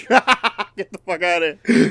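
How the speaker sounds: high, slow laugh-through disbelief; laughing in real amazement. Real, not acted. Player laughs at nat twenty.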